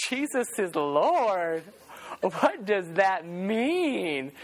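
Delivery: put-on slow, baffled girlfriend voice, drawn out; puzzled girlfriend. Girlfriend on the phone hearing mom's greeting, slow and baffled. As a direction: puzzle over each word slowly. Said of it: "she's like".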